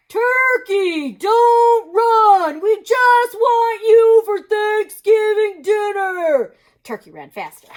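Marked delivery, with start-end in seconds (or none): 0.1-6.6 high, loud call, slow at the end; moose calling after. Acted. Mister Moose calls after fleeing Turkey, cheerily loud. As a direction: shout after him cheerily.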